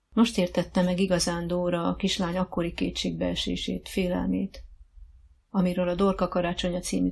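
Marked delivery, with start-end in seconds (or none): none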